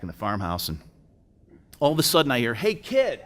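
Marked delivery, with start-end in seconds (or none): 2.5-3.2 calling out. Retold or imitated. Stranger calling out to boy.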